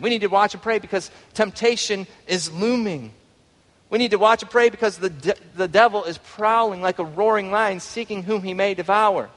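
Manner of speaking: preached with rising urgency; preaching exhortation. Acted. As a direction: raise it into a fervent preaching call.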